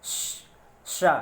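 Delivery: "श-" hissed out long like a whistle, then "शा" said clearly; hissing sh sound. Shown as a demonstration. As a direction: let the air hiss through the palate on "श-" before "शा". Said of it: "सीटी की आवाज़ निकालेंगे कैसे". ("श" को सीटी जैसी लंबी सिसकारी में, फिर साफ़ "शा")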